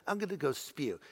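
put-on soft, playful British accent; mock British accent. Preacher playing someone about to be sick, mock British accent. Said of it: "I don't know why you'd have a British accent".

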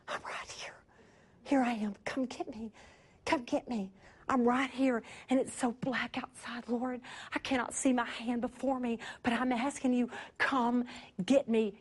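soft, low whispered plea; soft prayer. Acted. Believer calling quietly to God in the dark. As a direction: call out to the Lord, hushed and pleading.

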